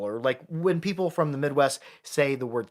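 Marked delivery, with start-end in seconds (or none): none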